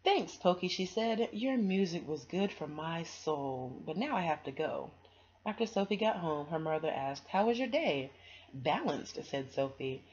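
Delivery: put-on bright, lively reading to children; storybook read-aloud. A narrator's reading. Reader sharing a picture book with kids, lively storybook voice. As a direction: read it like bedtime story time.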